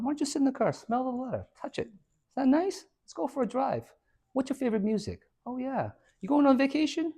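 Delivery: high, soft, coaxing salesman voice; smooth car salesman. Retold or imitated. Car dealer charming the buyer, high and soft. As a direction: sweet-talk the buyer softly.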